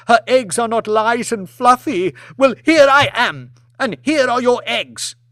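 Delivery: high, loud, shrieking outrage; a humiliated wife exploding at her husband; enraged lady screaming at the lord in front of guests; furious tirade. Acted.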